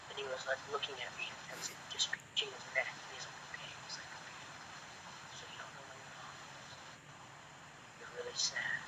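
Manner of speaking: hushed unvoiced whisper of a director's notes. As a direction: whisper the notes close to the ear. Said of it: "just whispering these, like, act, these directorial notes".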